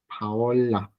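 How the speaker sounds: "Paola" with the L held, like Paol-la; drawn-out double L in "Paola"; long L. Shown as a demonstration. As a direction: lengthen the L in "Paola". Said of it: "sería una L larga".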